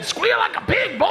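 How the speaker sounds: put-on high shouted Southern accent. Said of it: "accents like South Carolina and Georgia".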